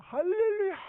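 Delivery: high, jubilant held cry; hammed-up ecstatic praise; dancing for joy. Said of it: "요나가 막 춤을 추는 거야".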